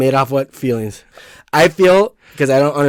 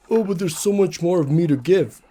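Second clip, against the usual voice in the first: mock whiny partner; 7 semitones higher than usual. Mocking a partner's excuse.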